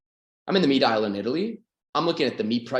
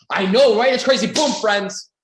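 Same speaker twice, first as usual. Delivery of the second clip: higher and much louder eager agreement; excited agreement; 7 semitones higher, 8 dB louder, slower than usual. Acted. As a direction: burst out agreeing like a new friend. Stranger agreeing at gas pump.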